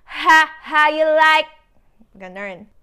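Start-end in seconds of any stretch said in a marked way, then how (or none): 0.1-1.5 louder and higher, taunting; mock K-pop swagger; challenging attitude. Shown as a demonstration. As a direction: throw it out like a dare. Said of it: "magkakaroon ng attitude sa".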